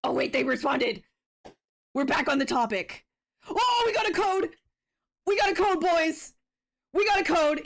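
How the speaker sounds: loud, high, thrilled yelling; excited shouting. Real, not acted. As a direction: shout it out in pure triumph. Streamer gets code, celebrates.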